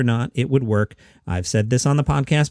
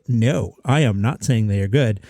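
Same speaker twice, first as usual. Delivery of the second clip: ordinary delivery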